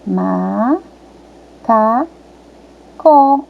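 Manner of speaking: "Ma, cá, có" as three isolated beats; "Ma, cá, có" stepping up in pitch to the last; syllable by syllable. Shown as a demonstration. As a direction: sound out "Ma, cá, có" with long pauses. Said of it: "tem que ser o C com O".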